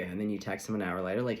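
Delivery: ordinary talk, nothing marked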